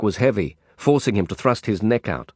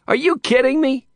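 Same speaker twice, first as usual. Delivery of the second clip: high, indignant disbelief; outraged disbelief; 4 dB louder than usual. A narrator's reading. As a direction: scoff at the price. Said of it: "the shorter one exploded".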